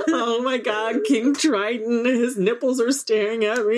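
high laughing half-sob; laugh-cry through the memory; mock happy crying. Friends reliving the ride, laughing and mock-sobbing.

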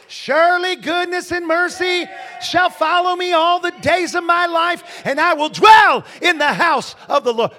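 very high, very loud scripture proclamation; full-force preaching shout. Real, not acted. Preacher proclaims psalm to congregation, full-force shout. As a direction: shout the verse out to the whole church.

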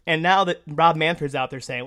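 ordinary talk

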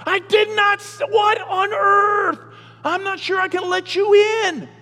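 high, loud hammed-up disbelief; mock shock. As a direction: overplay a shocked, outraged God. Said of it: "God's gonna say".

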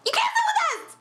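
catty high-school queen bee, laughing; Mean Girls voice. Pretend. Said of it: "In my Mean Girls voice".

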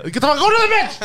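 high, shouted send-off; yelling referee. Pretend. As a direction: bark a player off the field. Acting as angry referee.

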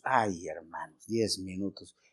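a long, low, soft groan on "Ay, hermanos, diez minutos"; anguished echo. Acted. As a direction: let "diez minutos" sink down, drawn out and weary. Echoing the nurse in anguish.

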